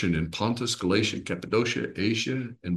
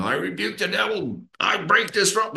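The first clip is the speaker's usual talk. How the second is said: much higher, hammed-up rebuking of the devil; mock charismatic prayer; 10 semitones higher than usual. Teacher mocking charismatic prayer, high hammed-up rebuke. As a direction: lampoon a fiery charismatic prayer warrior. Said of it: "instead of going all charismatic and say".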